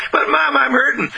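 high-pitched acted child's complaint; hollering unhappy child. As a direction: break in high like a hurt kid. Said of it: "that screaming, hollering, unhappy child".

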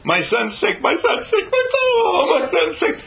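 very high, loud mock wailing; laughing mock lament. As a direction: wail it in hammed-up despair.

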